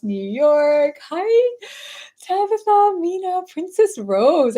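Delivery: high, loud, delighted roll call; excited greeting. Real, not acted. Host greeting viewers as they join live, excited. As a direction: call out to each arrival with delight.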